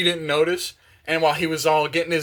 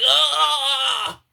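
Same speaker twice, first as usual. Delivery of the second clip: high, drawn-out acted scream; hammed-up yell of someone being crushed; mock scream; 10 semitones higher, 4 dB louder than usual. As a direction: scream in play, high and held. Character caught in the bird's jaw.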